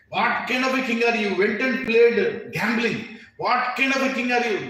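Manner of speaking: loud, high scornful taunting; loud scornful brother; contemptuous insult. Retold or imitated. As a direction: insult him to the core.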